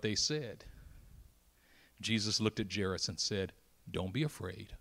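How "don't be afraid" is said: soft, low and slow; calm the father gently; reassuring. Acted. Jesus calming Jairus after news of death, soft and slow.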